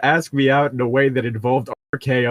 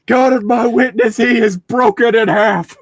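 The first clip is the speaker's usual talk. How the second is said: loud, higher, slowed commentator call; mock commentator cry; 9 semitones higher, 7 dB louder than usual. Quoting the announcer for friends, loud dramatic call. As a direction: call it like ringside announcer in shock.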